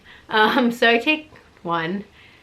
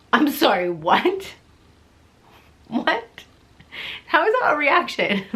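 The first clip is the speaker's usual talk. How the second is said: incredulous; 8 semitones higher, 3 dB louder than usual. Real, not acted. Incredulous at his lunch question.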